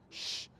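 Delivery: soft drawn-out shh with no voice; shushing sound. Shown as a demonstration. Trainer hushing a howling dog with a soft shh. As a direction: hush the dog with a long shh. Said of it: "I have to make my sound first".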